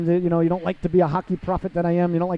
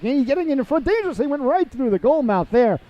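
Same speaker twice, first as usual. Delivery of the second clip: excited; 9 semitones higher, 3 dB louder than usual. Real, not acted. Sudden scoring chance in play-by-play.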